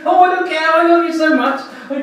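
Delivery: squeaky, high, shouting overjoyed dog; excited dog. Pretend. Dog greeting its owner home from work with frantic joy. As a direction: gush like a dog that missed you all day.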